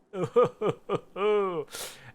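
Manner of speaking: high, loud wicked laugh; crone's cackle. A narrator's reading. Crone gloating over trapped Ginty, cackling. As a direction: cackle slowly like an old hag.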